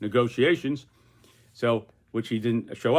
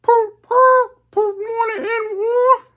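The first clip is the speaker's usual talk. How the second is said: parrot voice; 10 dB louder than usual. Acted. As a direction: screech it like a parrot. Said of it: "Boris the Parrot says".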